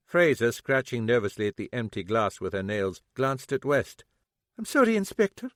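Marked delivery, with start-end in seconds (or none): none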